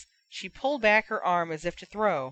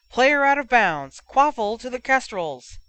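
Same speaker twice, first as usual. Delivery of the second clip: higher, louder official call; referee announcing; 5 semitones higher, 7 dB louder than usual. Acted. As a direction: announce the ruling across the pitch.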